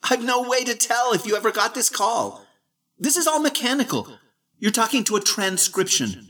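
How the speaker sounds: put-on young voice over a phone, matter-of-fact; young Barton. A narrator's reading.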